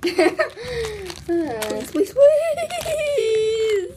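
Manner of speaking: high pleading through laughter; laughing plea. Real, not acted. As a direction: beg while laughing. Begging before another turn.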